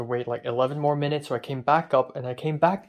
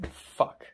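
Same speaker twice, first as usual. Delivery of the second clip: long breathy sigh, then a whispered curse; exasperated sigh and curse; 3 dB quieter than usual. Real, not acted. Fed up with the laundry, sighing then cursing.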